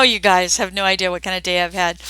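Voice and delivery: ordinary talk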